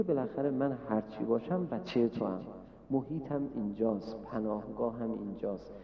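put-on low, very soft voice of the humbled child; subdued plea. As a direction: quietly plead to still belong. Failed child pleads quietly for belonging. (التماس آرام و فروتنانه)

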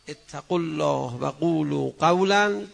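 loud, slow chanted verse; Quranic recitation. Real, not acted. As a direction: chant the verse for the crowd to finish. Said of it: "بقیه شما بفرمایید".

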